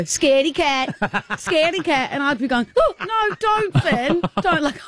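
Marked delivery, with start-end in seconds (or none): 0.0-2.1 higher teasing sing-song; mock taunting kid. Boy teasing Lou, high mock taunt. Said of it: "and he'd go". 2.8-4.6 high pleading no; mock scared. Lou pleading with Finn, high and mock scared. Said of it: "I was honestly scared".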